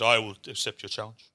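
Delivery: lightly voiced English nobleman; a hint of formal English gentleman. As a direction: accept it with understated noble formality. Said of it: "English nobleman stands up".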